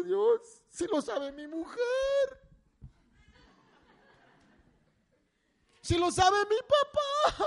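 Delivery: high, loud, slow wailing self-pity; mock sobbing plea. Preacher mocking a sinner afraid his wife finds out, wailing. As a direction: wail to God like a caught sinner begging pity. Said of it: "Y no es".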